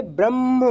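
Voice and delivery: "ब्रह्म" as bramm, म leading; म before ह. Shown as a demonstration. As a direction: close early on a doubled म in "ब्रह्म". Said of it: "ये में माँ पहले सुनाई दे रहा है". ("ब्रह्म" में म पहले, ब्रम्म जैसा)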